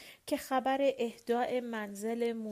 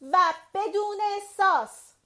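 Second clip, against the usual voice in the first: high, loud, grating squawk; shrill loud jab; screechy voice; 9 semitones higher, 9 dB louder than usual. A narrator's reading. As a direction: screech the last demand. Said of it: "با صدای گوشخراش گفت".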